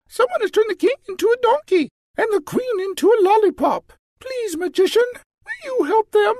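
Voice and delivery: put-on very high, fast, urgent child's plea; tearful little princess. Little princess begging the magician to help. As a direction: beg in a rush, near tears. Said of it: "asked the princess".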